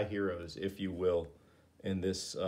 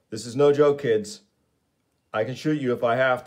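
a loud, hard warning; loud threat; 4 semitones higher, 8 dB louder than usual. Acted. Sarge Steele warns the teens he will shoot, loudly. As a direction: bark it as a real threat.

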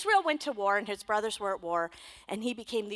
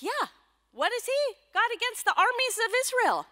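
put-on bold young David; 11 semitones higher, 4 dB louder than usual.

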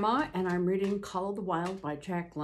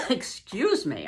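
usual speaking voice

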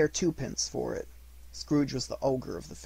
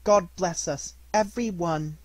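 put-on high small child's voice, lightly done; Tiny Tim; 6 semitones higher, 6 dB louder than usual. Tiny Tim echoes his father's toast in a child's voice. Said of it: "said Tiny Tim".

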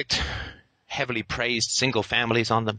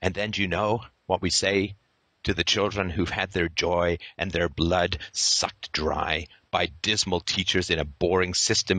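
normal talking voice

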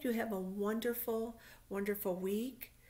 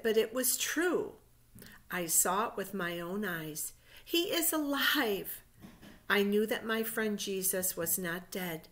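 reading a Bible story aloud, louder and measured; story narration; 7 dB louder than usual. A narrator's reading. Teacher reading Easter story to children, storybook narration. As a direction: tell it like a picture-book story to little ones.

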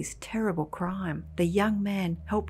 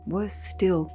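plain reading voice

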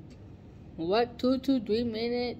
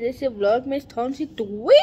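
loud, higher announcement; yelling; 5 semitones higher, 10 dB louder than usual. Real, not acted. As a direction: shout the show title. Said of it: "I need to stop yelling".

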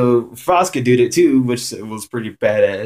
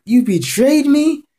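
outraged disbelief; 12 semitones higher, slower than usual. Retold or imitated. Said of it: "Frost was like".

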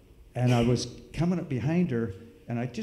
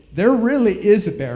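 louder, higher, pleading shout; loud desperate shout; 8 semitones higher, 9 dB louder than usual. Retold or imitated. Third warning to the family, loud desperate shout. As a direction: shout it so they finally believe you. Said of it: "I said".

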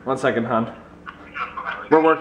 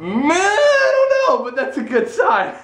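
excited. Real, not acted. Excited reaction.

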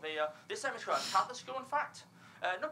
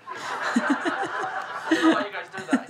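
usual speaking voice

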